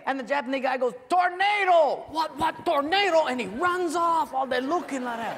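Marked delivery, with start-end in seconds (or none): 1.1-2.1 high, loud mock warning; shouted alarm. Prisoner distracting the firing squad, high alarmed shout. 2.1-3.2 high, loud startled cry; mock panic. Startled firing squad reacting to the warning, mock panic.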